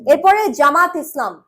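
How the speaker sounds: normal talking voice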